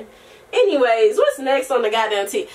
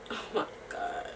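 whisper; 17 dB quieter than usual. Real, not acted. Cooing at baby.

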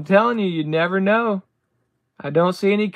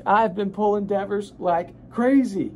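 ordinary talk, nothing marked

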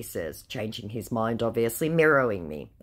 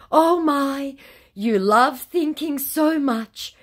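high, louder, slow put-on oh my; mock patronising scammer; 13 semitones higher, 6 dB louder, slower than usual. Scammer brushing off her question, mock patronising. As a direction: coo it condescendingly.

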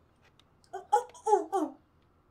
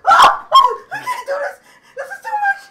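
high, loud panicked laughter; laughing panic; 13 dB louder than usual. Real, not acted. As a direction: laugh through the fear. Freaked out by VR height.

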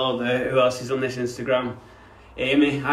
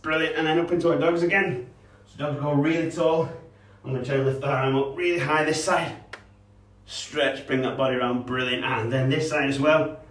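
put-on higher, bright instructing voice for kids; kids' fitness instructor guiding a warm-up; upbeat PE coach cues; 3 semitones higher than usual. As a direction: coach the stretches with energy. Leads dog and snake poses.

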